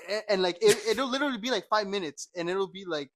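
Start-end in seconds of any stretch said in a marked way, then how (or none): none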